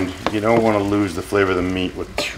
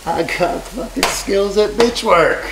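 mock brag; 10 semitones higher, slower than usual. Bragging in a put-on voice.

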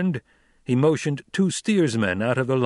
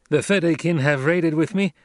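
raised, proud declaration; Paul proclaiming; 5 semitones higher, 4 dB louder than usual. A narrator's reading. Paul declaring himself Fremen, proud. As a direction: declare it to the troop.

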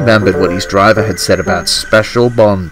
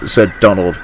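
ordinary delivery, nothing marked

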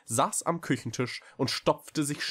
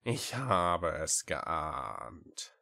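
very slow, low, sighing words; resigned sigh; 5 semitones lower, much slower than usual. A narrator's reading. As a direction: drag it out with a weary sigh. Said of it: "seufzte sie". (gedehnt und tief seufzend)

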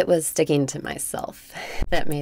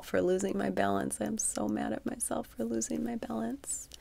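frustrated; 7 semitones higher, 5 dB quieter than usual. Real, not acted. Mock frustration at herself.

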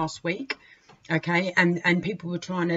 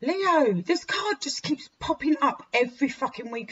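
frustrated; 8 semitones higher than usual. Real, not acted. Angry at card recurring.

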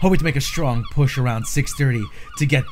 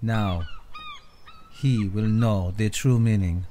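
hushed nature documentary. Acted. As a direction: narrate like a wildlife film. Narrator mocks his challenge.

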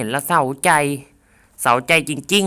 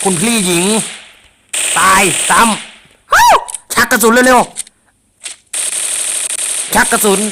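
slow, stretched, higher calls; frantic drawn-out cries; 6 semitones higher, 3 dB louder, much slower than usual. Real, not acted. Gamer crying out mid-fight, stretched and frantic. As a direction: drag each word out in panic.

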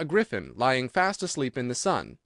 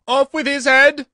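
a queen screaming a threat; furious shout; 13 semitones higher, 11 dB louder than usual. A narrator's reading. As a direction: roar the warning at the Duchess. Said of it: "and shouting".

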